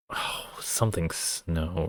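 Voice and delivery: soft, slow unvoiced whisper of "something Snow". Real, not acted. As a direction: whisper it to yourself while trying to remember. Unsure aside.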